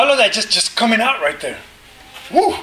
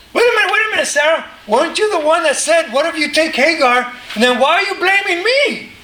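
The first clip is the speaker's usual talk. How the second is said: husband protesting; 7 semitones higher than usual. Acted. Husband answers wife in quarrel.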